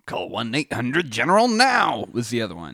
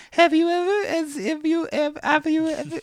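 put-on very high stuttering kid voice; child voice; 5 dB louder than usual. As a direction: repeat it like an eager little kid. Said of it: "say it in a childlike voice".

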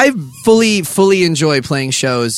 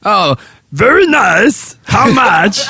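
put-on higher, louder slow Borat Kazakh accent; Borat impression; 8 semitones higher, 4 dB louder, much slower than usual. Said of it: "is my Borat good yet".

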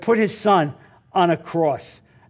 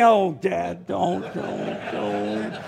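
embarrassed plea; 4 semitones lower, 6 dB quieter, much slower than usual. Retold or imitated. Embarrassed son begs father.

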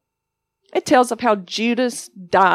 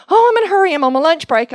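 hurried lady; 7 semitones higher, 6 dB louder, much faster than usual. Retold or imitated. Rushed shopper bursts in.

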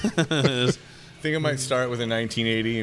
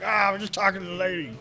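put-on slurred, higher drunk voice; town drunk; 6 semitones higher than usual. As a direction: play a rambling town drunk. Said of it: "Town drunk".

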